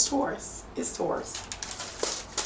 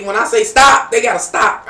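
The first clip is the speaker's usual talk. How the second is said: emphatic; 6 semitones higher, 13 dB louder than usual. Real, not acted. Insisting on safe word.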